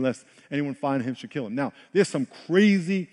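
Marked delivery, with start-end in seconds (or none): none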